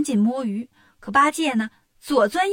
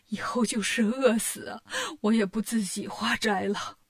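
tearful; 3 semitones lower, 5 dB quieter than usual. Acted. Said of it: "唐僧一边抹眼泪".

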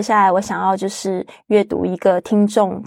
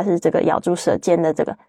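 usual speaking voice

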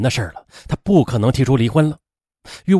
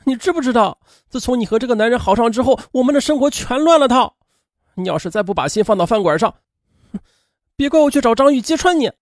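higher, heated threat; still angry; 8 semitones higher than usual. A narrator's reading. Friend threatens to expose the affair, high heated voice. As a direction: lay down an angry ultimatum. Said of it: "杨桃这气儿还没消".

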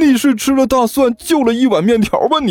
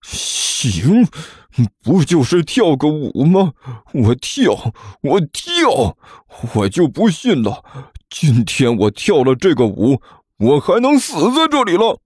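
seething defiance, climbing higher; through gritted teeth; 6 semitones higher, slower than usual. Acted. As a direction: grit your teeth and accept the dare. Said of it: "指着甘球磨着牙说道". (磨着牙赌气应战)

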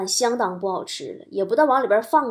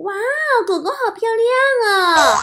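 high-pitched, gushing wow; delighted exclamation; 11 semitones higher than usual. Retold or imitated. Passing woman to a little dog, gushing admiration. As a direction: imitate a young woman cooing over a dog. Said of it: "冲着这个狗啊就惊呼".

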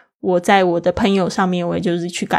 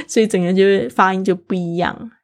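ordinary talk

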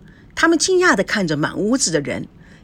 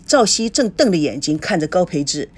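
ordinary delivery, nothing marked

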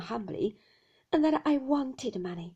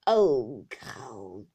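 low groan into a guttural rattle; deep, throaty growl; throat rattle; 10 semitones lower, 6 dB louder than usual. A narrator's reading. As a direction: squeeze it out of the throat with force. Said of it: "which he scrawled out of himself".

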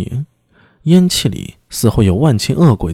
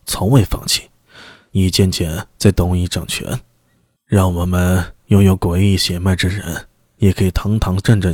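low, brooding storyteller lord; low-voiced envoy; 4 semitones lower than usual. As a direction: recount the clan's fall, low and grave. Envoy recounts clan history.

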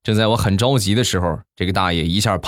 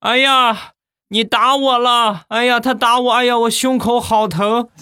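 old man's fake wail; slower than usual. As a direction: wail like a faking old man clutching his chest. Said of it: "大声地喊".

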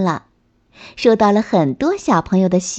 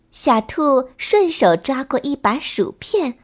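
plain reading voice

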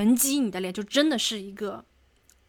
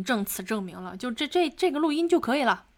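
normal talking voice

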